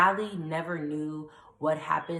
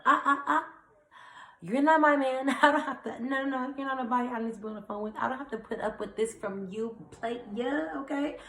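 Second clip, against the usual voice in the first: retold sassy telling-off; 8 semitones higher than usual. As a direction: shut him down with mock outrage, fast.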